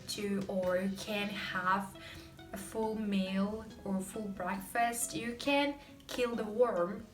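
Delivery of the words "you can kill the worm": usual speaking voice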